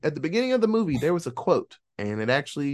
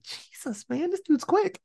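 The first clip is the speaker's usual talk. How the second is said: stunned reaction; 13 semitones higher, 3 dB quieter than usual. Retold or imitated. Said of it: "I was like".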